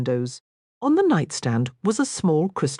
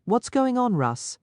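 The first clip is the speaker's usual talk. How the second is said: feminine voice; 7 semitones higher than usual. A narrator's reading. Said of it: "a feminine voice".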